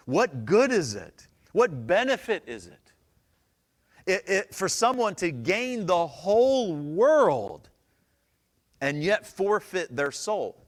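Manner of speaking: loud, slow, high preaching read; emphatic scripture reading. Acted. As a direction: proclaim it slowly to the room.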